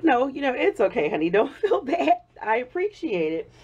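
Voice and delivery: reassuring him through high giggles; laughing reply. Retold or imitated. Reassuring her boyfriend in the retelling, still laughing high. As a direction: say it kindly but keep breaking into laughter. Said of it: "I keep laughing".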